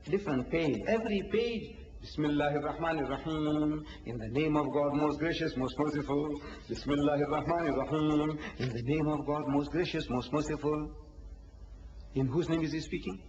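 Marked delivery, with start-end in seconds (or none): 2.0-10.9 low, measured chant on a level tone; sacred recitation. Shown as a demonstration. Speaker demonstrating the opening of each chapter, solemnly intoned.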